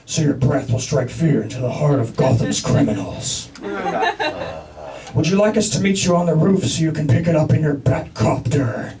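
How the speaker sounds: low Batman growl taking the order. Retold or imitated. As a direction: play the brooding hero on the phone. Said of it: "I'm saying things like".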